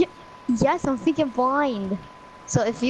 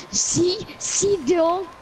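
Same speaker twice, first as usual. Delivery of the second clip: pitched-up, teasing imitation of a friend's trash talk; mock smack talk; 7 semitones higher, much slower than usual. Friend mimicking his teammate's smack talk, mock gloating. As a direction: parrot his gloating back at him. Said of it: "funny the way you smack talk".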